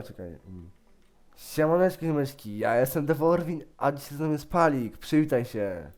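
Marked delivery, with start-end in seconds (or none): 1.4-5.9 lively host greeting to viewers; YouTuber intro. Pretend. Boy greeting viewers and introducing his guest, upbeat YouTuber intro. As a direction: launch the video with showy energy.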